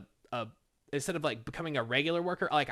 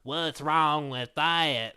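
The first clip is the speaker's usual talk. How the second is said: drawn-out, louder mock Rita voice; coworker Rita's retort; 5 dB louder, much slower than usual. Rita asking the narrator what's wrong with cousins marrying, mock drawn-out voice. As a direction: stretch it out like a puzzled old coworker. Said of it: "She's like".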